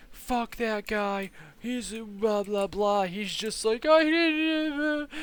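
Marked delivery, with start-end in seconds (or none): 0.1-3.1 high-pitched mock complaint; mock griping. Victim who isn't bitter about his attacker, mock griping. Hypothetical angry reaction the victim didn't have. 3.8-5.1 very high mock crying voice; imitated bawling. Beaten old man trying to explain, imitated bawling. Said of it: "still bawling his eyes out".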